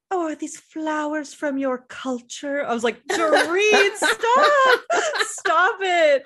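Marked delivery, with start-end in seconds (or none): 0.1-2.6 high, slow affected socialite; precious, drawn-out mock curiosity; Dorit. Host imitating Dorit at a Haitian dinner, high and affected. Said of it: "she was like". 3.1-6.2 very high, loud laughing protest; mock exasperated cry. Host begging Dorit to stop, playfully exasperated. Acted plea to an absent Dorit.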